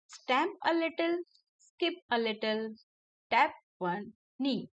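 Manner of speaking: each line of the rhyme said very slowly; slow poem reading. Acted. Teacher reading the rhyme slowly to children, paced recitation. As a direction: read the rhyme slowly for kids to follow.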